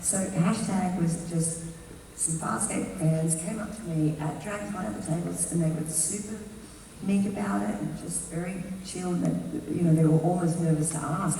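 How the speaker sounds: ordinary talk, nothing marked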